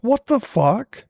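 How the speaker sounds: very high, loud freaking-out yell; panicked shout. Retold or imitated. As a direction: scream in horror at nineteenth place. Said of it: "and I was like".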